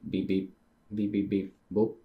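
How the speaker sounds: short spoken beeps and a boop; beep-boop device noises; imitated electronic beeps. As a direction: mimic a beeping machine by mouth. Voicing a machine's noises while reading.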